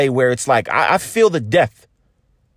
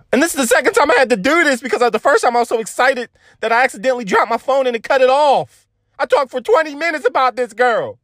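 excited rush; 7 semitones higher than usual. Real, not acted. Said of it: "excited rant in parked car".

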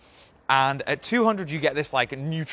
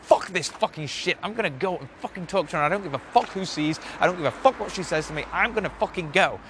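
higher-pitched, fired-up defiance; pissed off; 4 semitones higher, faster than usual. Acted. Speaker pushing himself to talk to a girl, pissed off. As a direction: psych yourself up with angry resolve. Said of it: "you get fucking pissed off at your fear".

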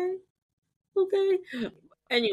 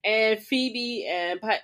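ordinary talk

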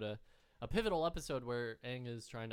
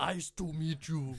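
Schwarzenegger impression; 4 semitones higher, 7 dB louder, slower than usual. Shown as a demonstration. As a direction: do your best Arnold. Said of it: "that's the best one I can do".

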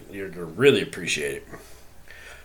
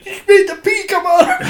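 much higher, louder, laughing through the words; laughing child shriek; 11 dB louder than usual. Retold or imitated. Retelling the scared son's cry, much higher and louder, laughing. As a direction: squeal it while cracking up. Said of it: "and he's like".

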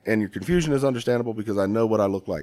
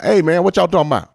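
imitating Lumbee locals; 8 semitones higher, 9 dB louder than usual. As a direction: mimic the locals lazily calling out. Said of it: "hordes of Indians walking around talking about".

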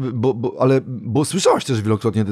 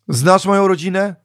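mock quiz; 9 semitones higher, 5 dB louder than usual. Quizzing the loving star.

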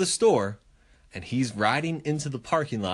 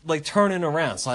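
usual speaking voice

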